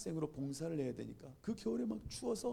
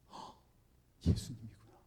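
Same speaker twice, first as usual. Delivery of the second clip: hushed realization; 6 semitones lower than usual. Retold or imitated. Student realizing it was Jesus, hushed. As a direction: breathe it out, struck by the truth. Stricken realization about the stranger.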